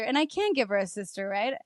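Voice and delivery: ordinary talk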